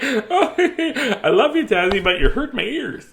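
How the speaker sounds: high, giggling mimicry; squealing laughter spilling into words; imitated giggly complaint. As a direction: mimic someone laughing helplessly, drawn-out and high. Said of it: "she's, like".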